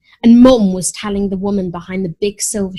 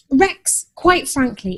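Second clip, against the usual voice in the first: usual speaking voice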